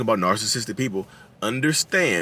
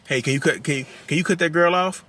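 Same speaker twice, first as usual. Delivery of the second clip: higher, halting mock request; tentative request; 6 semitones higher than usual. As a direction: act out someone hesitantly asking a favour. Acting out the listener's timid request.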